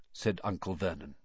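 ordinary delivery

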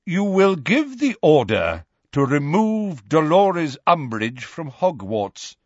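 stern, measured command. A narrator's reading. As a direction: lay down terms firmly, slowing at the end. Dumbledore gives Fudge orders.

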